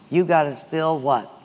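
ordinary talk, nothing marked

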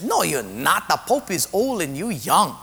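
put-on sing-song comic Portuguese accent. Second patient contradicting the first, comic Portuguese accent. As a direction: argue back in a bouncy Portuguese accent. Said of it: "The second Portuguese guy says".

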